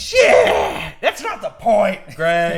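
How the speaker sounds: sudden loud, high curse; frustrated outburst. Real, not acted. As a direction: blurt it out in real annoyance. Frustrated over graphics mistake.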